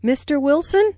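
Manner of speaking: "Mister Wilson" said with an upward tune; rising intonation. Shown as a demonstration. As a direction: address him with a lift on "Wilson". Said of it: "Notice the rising intonation on".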